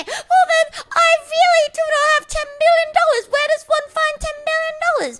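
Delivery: high, hammed-up whining wail; theatrical despairing whine; mock crying. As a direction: plead like a broke, weeping driver. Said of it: "would cry and say".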